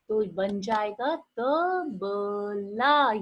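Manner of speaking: तो बन जाएगा tabla drawn out very slowly, tabla stretched long; slow sounding out. Shown as a demonstration. Teacher drawing out tabla for her pupils, slowly. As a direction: spell the word out slowly for little kids. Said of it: "आ की आवाज last में आ रही है".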